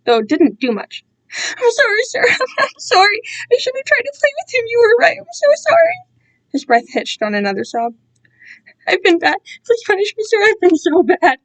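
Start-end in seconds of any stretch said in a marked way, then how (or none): 1.6-5.9 high, tearful sobbing apology; childlike sobbing, pitched high; tearful pleading. Acted. Crying apology to captor. 8.9-11.4 high, tearful plea; tearful pleading. Acted. Crying plea for punishment.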